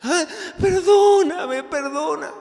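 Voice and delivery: husband caught with lipstick on his neck, wailing for forgiveness; wailing plea. Acted. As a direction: overdo the sobbing beg. Husband caught, begging again.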